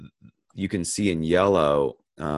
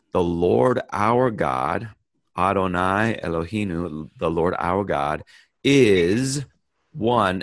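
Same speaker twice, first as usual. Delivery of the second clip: slow, with weight on is and one; emphatic reading; 4 dB louder than usual. Shown as a demonstration. Teacher reading one translation aloud, emphatic. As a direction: lean on is, then one. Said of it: "one version might be".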